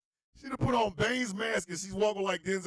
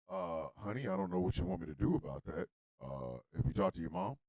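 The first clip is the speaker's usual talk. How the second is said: low, soft, uneasy reply; quiet, stumped grandfather; hesitant grandpa; 11 semitones lower, 7 dB quieter than usual. Acted. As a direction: answer low and carefully. Acting grandpa on the phone.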